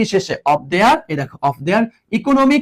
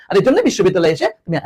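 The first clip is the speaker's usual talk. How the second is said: ordinary talk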